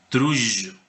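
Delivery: trullo with a buzzing, bee-like J; medieval pronunciation. Shown as a demonstration. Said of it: "la usanza medieval para pronunciar esta palabra era esa".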